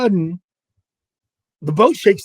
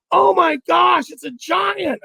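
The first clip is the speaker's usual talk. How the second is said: high, loud burst of amazement; thrilled imitation; 12 semitones higher, 5 dB louder, slower than usual. Partner sees the netted fish, wildly excited. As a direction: imitate an angler overwhelmed by the catch.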